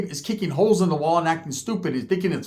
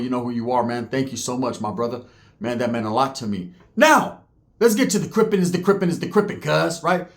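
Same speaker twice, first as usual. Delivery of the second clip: normal talking voice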